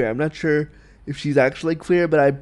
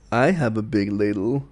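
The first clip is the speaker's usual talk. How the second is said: lightly lowered voice for a silly innuendo; mock-suggestive inner voice; 6 semitones lower, 4 dB quieter than usual. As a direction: say it low, as a naughty private thought.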